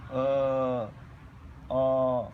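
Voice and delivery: low-key "A, a", each held long and loud; loud, sustained belch-like vowels. Shown as a demonstration. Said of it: "belching sound like".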